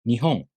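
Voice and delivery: Nihon starting low, rising on the second beat; low-high pitch accent. Shown as a demonstration. Teacher giving an example of the first rule, low-then-high accent. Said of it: "The first molar starts low in pitch".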